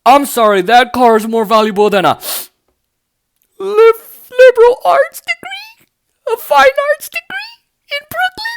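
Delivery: high, wailing mock sob over a degree; mock crying pundit. Pundit mocking degrees, in a high fake wail. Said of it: "I love how he's like".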